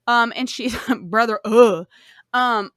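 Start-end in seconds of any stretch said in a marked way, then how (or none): 1.4-1.9 loud disgusted groan; fed-up ugh at the story; exasperated ugh. Real, not acted.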